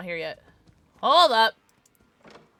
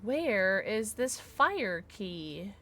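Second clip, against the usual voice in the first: slow, softer, drawn-out question; slow searching question; 3 semitones lower, 6 dB quieter, much slower than usual. Real, not acted. Player wondering aloud where the key is, slow and soft. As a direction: draw it out quietly, stretching every word.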